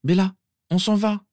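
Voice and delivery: little sister begging Bellatrix, pleading. A narrator's reading. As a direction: beg your sister to leave now. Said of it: "supplia Narcissa".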